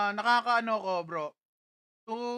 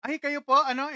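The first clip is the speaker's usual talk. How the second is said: ordinary talk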